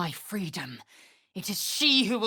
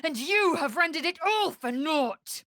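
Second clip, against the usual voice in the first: higher, anguished reproach; pained, higher accusation; bitter accusation; 9 semitones higher than usual. Acted. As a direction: throw the betrayal back at them.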